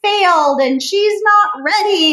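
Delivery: exaggerated anxious mom voice; mock-panicked worry. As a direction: play up your own frantic doubts. Retelling her own anxious thoughts.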